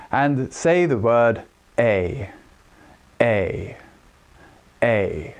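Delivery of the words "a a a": low, resonant A; chest resonance. Shown as a demonstration. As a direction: say A from below the breastbone. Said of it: "feel how it resonates in this area".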